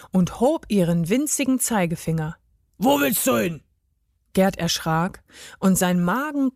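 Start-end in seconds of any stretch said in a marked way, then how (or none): 2.8-3.6 put-on gruff elf challenge; deep-voiced elf. A narrator's reading. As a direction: challenge a traveller as a bearded elf. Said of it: "rief sie mit tiefer Stimme". (verstellte, herrische Elfenstimme)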